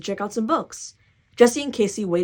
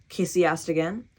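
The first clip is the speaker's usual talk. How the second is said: usual speaking voice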